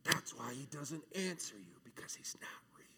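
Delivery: sinister whisper of an inner doubt. Acted. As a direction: hiss it like a voice in your head. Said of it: "And the doubt says".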